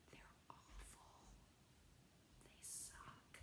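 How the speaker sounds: barely audible confiding whisper; secretive whisper. Pretend. Teacher confides about her husband's poems, whispering. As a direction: whisper it so he won't overhear. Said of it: "I have to be quiet".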